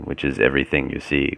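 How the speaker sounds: normal talking voice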